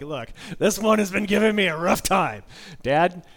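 loud, high, fed-up mom voice; exasperated mom. Acted. Worn-out mom handing the child to her husband, loud and exasperated. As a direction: raise your voice like a mom at her limit.

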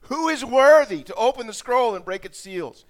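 high, loud cry for someone worthy; loud proclamation. Acted. Preacher voicing the mighty angel's question, loudly proclaiming. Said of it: "Proclaiming with a loud".

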